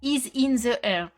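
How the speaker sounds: English with a real French accent; halting English, heavily French-accented; strong French accent. Real, not acted. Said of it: "Pardon pour mon accent, qui est catastrophique en anglais".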